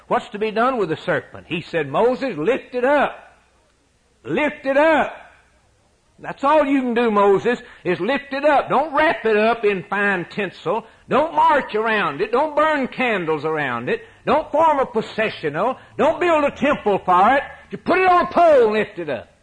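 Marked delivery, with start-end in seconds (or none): 1.9-5.2 slow, higher and louder command; God's command. Acted. God commanding Moses to raise the serpent, slow and booming. 6.2-19.3 higher, louder, climbing sermon crescendo; full-force preaching. Acted. Preacher urging the congregation with a rising, full-force climax.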